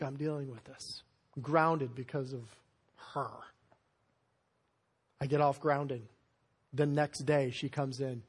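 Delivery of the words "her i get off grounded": ordinary talk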